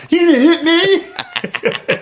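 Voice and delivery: very high, slow whining dare; mock tearful challenge. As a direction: wail it at dad, played for laughs. Retold tearful challenge to his father.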